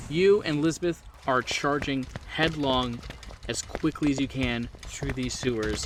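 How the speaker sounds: usual speaking voice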